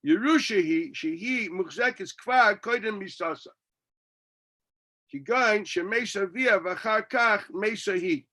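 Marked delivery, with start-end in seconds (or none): none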